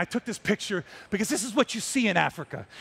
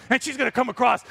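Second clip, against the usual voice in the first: ordinary talk, nothing marked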